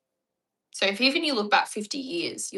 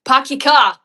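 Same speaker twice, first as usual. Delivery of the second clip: loud, high Boston accent with dropped R's; 8 semitones higher, 16 dB louder than usual. Shown as a demonstration. Australian showing off for viewers, loud Boston accent. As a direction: shout it in broad put-on Boston. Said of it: "Boston accent doesn't sound too far".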